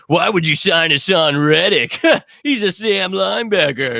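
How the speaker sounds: ape him as if the question is absurd; mocking impression. Mocking a clueless podcaster's question.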